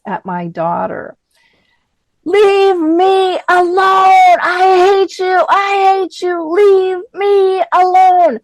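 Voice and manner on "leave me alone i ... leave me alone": full-force imitated scream, high and drawn out. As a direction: scream it slowly at the top of your lungs. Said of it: "screaming at the top of her lungs".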